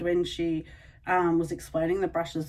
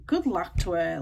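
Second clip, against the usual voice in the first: ordinary talk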